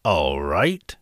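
low, lightly voiced troll giving in; grudging troll consent, pitched low; greedy troll. A narrator's reading. Troll answers the little goat's plea, low troll voice. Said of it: "The greedy troll thought about this".

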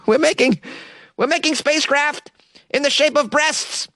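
high, hammed-up voice of an earnest activist; mock group member. Host voicing the feminist group's spacecraft plans, mock earnest. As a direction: play the group bragging with a laugh.

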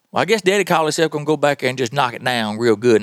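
normal talking voice

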